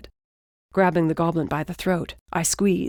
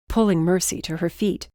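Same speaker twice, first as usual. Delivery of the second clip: ordinary delivery, nothing marked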